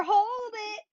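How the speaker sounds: high, strained call with a cry in it. Real, not acted. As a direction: push the cue out at full effort. Peak of hard exercise.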